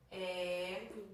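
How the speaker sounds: "Egoísmo" split into e, go, ís, mo; slow dictated "Egoísmo", pause before the last syllable. Shown as a demonstration. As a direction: stretch and separate each syllable of "Egoísmo". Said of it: "Vamos separar egoísmo".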